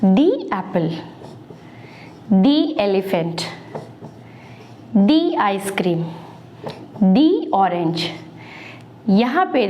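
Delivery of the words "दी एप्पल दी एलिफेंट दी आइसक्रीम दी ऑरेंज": दी एप्पल with a long "dee"; teacher modelling दी एप्पल, दी एलिफेंट; the before vowel. Shown as a demonstration. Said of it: "इसीलिए हम इसको पढ़ेंगे".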